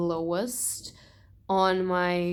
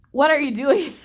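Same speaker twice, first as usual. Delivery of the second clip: exasperated question; 7 semitones higher, 9 dB louder than usual. Real, not acted. Ranting at the author.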